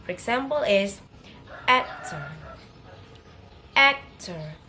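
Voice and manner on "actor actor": "actor" with AC louder and longer; stress on first syllable. Shown as a demonstration. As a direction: lean hard on the AC of "actor", let the end drop. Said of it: "say it a little louder and longer".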